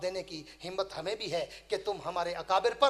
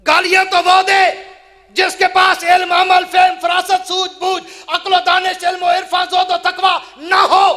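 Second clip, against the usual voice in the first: high, loud, ringing denunciation of the critics; fiery shout; 10 semitones higher, 18 dB louder than usual. Real, not acted. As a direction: thunder each quality at the crowd like a charge sheet. Preacher erupts at his critics. (ऊँची, गरजती आवाज़ में ललकार)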